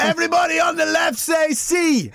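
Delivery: high-pitched hype-man shout; MC's crowd call-out; Big Nasty. Acted. Mimics Big Nasty working crowd.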